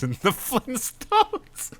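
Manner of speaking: high, slow words breaking into laughter; laughing while reading. Real, not acted. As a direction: lose it mid-line. Cracks up reading.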